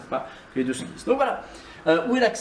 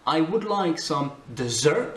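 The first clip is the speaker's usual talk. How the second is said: slow example, "dessert" said de-ZERT; stress on second syllable; 2 semitones higher, slower than usual. Shown as a demonstration. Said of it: "Si tu accentues sur la deuxième". (« dessert » accentué sur la deuxième syllabe)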